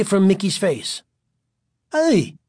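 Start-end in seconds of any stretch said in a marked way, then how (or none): 1.9-2.4 high, startled cry; surprised. A narrator's reading. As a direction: yelp in sudden protest. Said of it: "Mickey reacted in surprise".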